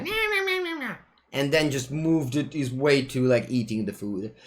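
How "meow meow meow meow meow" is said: high-pitched run of imitated meows; cat meowing. Streamer imitating the cat being picked up, meowing. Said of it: "the cat will be like".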